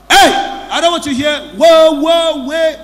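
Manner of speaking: loud, high shocked protest; shocked outcry. Real, not acted. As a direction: cry out to stop it, recoiling in disgust. Pastor recoils at confession.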